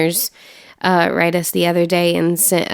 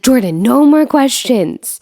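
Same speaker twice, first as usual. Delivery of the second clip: exasperated parent; 7 semitones higher, 4 dB louder than usual. Retold or imitated. Parent fed up with questions.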